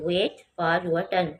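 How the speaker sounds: very slow, at normal volume; quiet slow repeat. Shown as a demonstration. Teacher repeating the sentence calmly after spelling "wait". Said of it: "W A I T".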